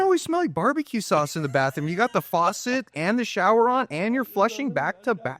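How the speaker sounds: high, suspicious wife voice; imitating wife. Husband mimics his wife questioning the bathroom smell, high suspicious. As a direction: needle him with high suspicion.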